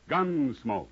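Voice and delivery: usual speaking voice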